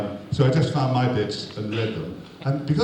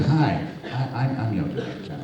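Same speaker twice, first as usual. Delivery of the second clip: a timid little director, voice shrinking to nothing; pitiful voice; 3 semitones lower than usual. Retold or imitated. A nervous little director introducing himself to the star, mock pitiful. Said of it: "in his pitiful voice".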